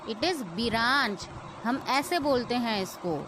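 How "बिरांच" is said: "बिरांच" split as bi-raanch; wrong pronunciation. Shown as a demonstration. Teacher showing how learners err, two-syllable "बिरांच". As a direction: add an i after b in "बिरांच". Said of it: "this is wrong pronunciation".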